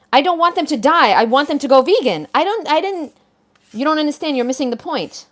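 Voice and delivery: frustrated outburst. Real, not acted. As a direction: burst out, fed up with being misunderstood. Exasperated rant defending her reel.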